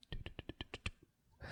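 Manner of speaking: airy whispered mic test; whispered mic check. Shown as a demonstration. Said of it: "so I can do, like, the frickin' whisper".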